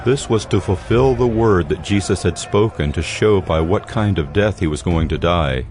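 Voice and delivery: normal talking voice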